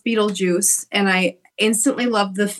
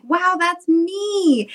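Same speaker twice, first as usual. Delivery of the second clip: amazed wow; 10 semitones higher, much slower than usual. Retold or imitated. Recalls discovering Lydia as a girl.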